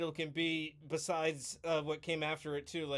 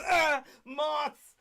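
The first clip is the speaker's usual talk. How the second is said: high, loud yelp of alarm; startled scream; 10 semitones higher, 7 dB louder than usual. Retold or imitated. Big lineman spots moths flying out, panicked yell. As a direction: shriek it like a big man terrified.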